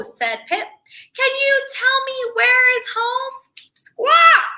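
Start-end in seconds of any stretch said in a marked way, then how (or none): 1.1-3.3 high small voice, asking hopefully; little penguin begging for directions, high-pitched; high penguin question. Acted. Pip asks gull. 3.7-4.5 high rasping bird squawk; gull squawk. Acted. Gull cries.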